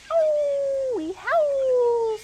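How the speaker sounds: high, loud howl on Ow; wolf pups howling. A narrator's reading. Wolfies answering father werewolf, high loud howl. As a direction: throw your head back and howl like a wolf pup. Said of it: "said the four".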